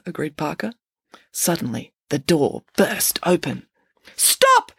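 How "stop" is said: high, loud yelled command. A narrator's reading. Friday bursts in to halt the teacher, yelling. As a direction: yell one word to halt the room. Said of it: "yelled Friday".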